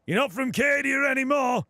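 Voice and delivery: loud, high northern bark; northern veteran shout. Shown as a demonstration. Voice actor barking at a recruit in his northern character voice. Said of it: "go talks like this".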